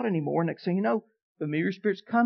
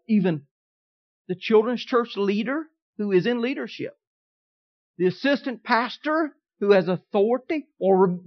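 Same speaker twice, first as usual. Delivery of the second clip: ordinary talk, nothing marked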